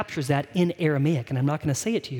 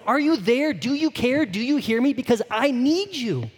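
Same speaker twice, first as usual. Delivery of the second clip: higher, pleading cry to God; desperate prayer; 7 semitones higher than usual. Acted. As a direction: demand an answer, stretching the final plea. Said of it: "this is a prayer of desperation".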